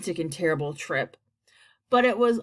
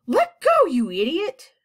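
high, loud angry shout; 8 semitones higher, 7 dB louder than usual. A narrator's reading. Centipede to James grabbing his legs, shouted. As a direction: yell it sharply, high-pitched at the start. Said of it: "shouted the centipede".

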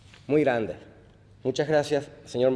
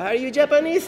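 mimicking strangers, higher and louder; quizzical quote; 10 semitones higher, 6 dB louder than usual. Strangers keep asking if he's Japanese, mock puzzled high voice. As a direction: toss it off like a curious stranger. People mistaking him for Japanese.

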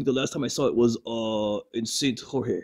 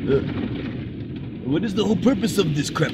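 ordinary talk

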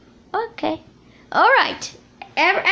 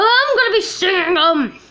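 ordinary talk

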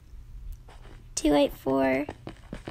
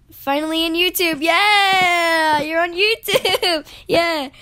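loud, higher, jubilant whoop; excited cheer; 4 semitones higher, 7 dB louder, slower than usual. Real, not acted. Gamer celebrating a friend on YouTube, excited cheer. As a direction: cheer with a laugh breaking in.

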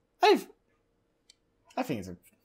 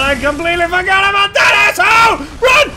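panicked shout; 10 semitones higher, 10 dB louder than usual. Real, not acted. Monster appears, fleeing.

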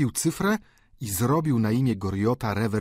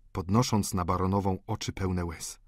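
ordinary delivery

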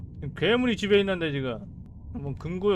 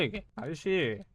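ordinary talk, nothing marked

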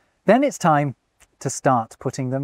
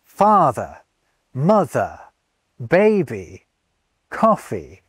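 FA-ther, MO-ther, BA-by, COF-fee, each set apart; "Father, mother, baby, coffee" as separate falling trochees; first-syllable stress; slower than usual. Shown as a demonstration. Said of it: "emphasis on the first syllable".

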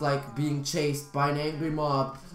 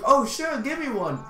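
higher, eager quoted acceptance; eager reply; 7 semitones higher than usual. Retold or imitated. Himself answering the guy offering chocolate, eager. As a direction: imitate jumping at the offer. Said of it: "I was like".

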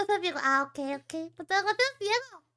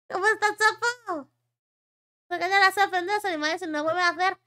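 usual speaking voice

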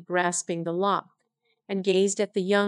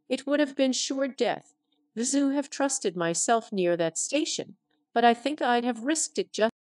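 ordinary delivery

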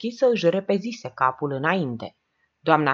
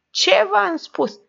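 laughing; 5 dB louder, much slower than usual. A narrator's reading. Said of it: "râse Hagianu".

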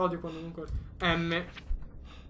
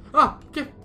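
startled scream; 11 semitones higher, 7 dB louder than usual. Real, not acted. Jump scare.

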